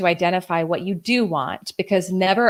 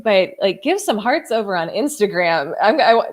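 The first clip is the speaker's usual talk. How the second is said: ordinary talk, nothing marked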